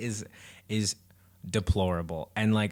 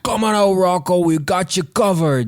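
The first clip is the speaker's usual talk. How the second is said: loud, higher New York cop shout; New York accent; 10 semitones higher, 8 dB louder than usual. Retold or imitated. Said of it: "There you go".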